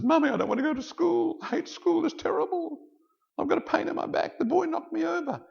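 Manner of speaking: put-on very high, loud little-boy wail; whining child. Boy complains to his mother about school, high acted whine. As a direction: act a hurt kid complaining in a squeaky voice. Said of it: "the kid goes home and says".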